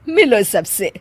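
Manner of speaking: usual speaking voice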